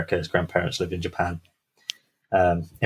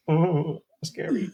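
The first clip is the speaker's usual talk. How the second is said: much higher, ironic little shudder; mock scared; 10 semitones higher, slower than usual. Storyteller joking about his own tale, mock scared and high. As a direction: pull a mock-frightened face in the voice.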